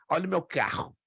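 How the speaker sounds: Rio accent, "carro" with a throaty h-like R; carioca accent. Shown as a demonstration. Teacher mimicking a carioca speaker in a Rio accent. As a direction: say it like a carioca showing off his car. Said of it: "você sabe que é carioca".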